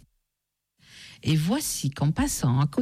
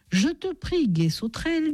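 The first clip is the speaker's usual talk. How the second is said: suddenly comforted; 9 semitones higher than usual. A narrator's reading. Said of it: "soudain consolée".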